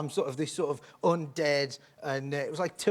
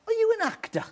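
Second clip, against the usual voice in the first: put-on very high, thin old-lady squeak; little old woman; 4 dB louder than usual. Old woman asks the clown if he acts, in a high squeak. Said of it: "And she went".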